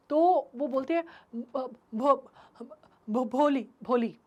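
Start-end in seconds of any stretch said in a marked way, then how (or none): 1.4-4.2 stammering out her name; stuck on the first sound, stammer it. Acted. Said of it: "She could stammer no further than that". (हकलाते हुए नाम बोलना)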